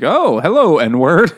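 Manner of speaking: high, loud, sing-out hello; mock chipper greeting. Boy greets his rescuer with a slur, loud mock-cheery hello. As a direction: imitate an oblivious boy's breezy greeting.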